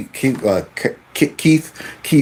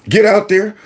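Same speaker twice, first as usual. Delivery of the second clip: usual speaking voice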